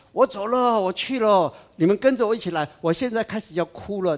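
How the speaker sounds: loud, high calling voice; Mary calling out. Retold or imitated. Mary calling the mourners to weep with her, loud. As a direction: call the crowd to follow her. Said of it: "她没有叫说".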